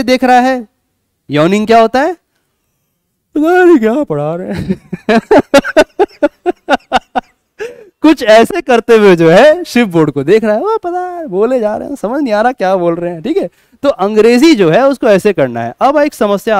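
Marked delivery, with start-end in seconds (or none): 3.3-4.9 mock bored student, mumbling through a yawn; a hint of a bored, yawning pupil. 4.9-7.2 high, loud burst of laughter; real amused laugh. Real, not acted. Laughs while acting student. 8.0-13.2 mock bored student, mumbling through a yawn; a hint of a bored, yawning pupil.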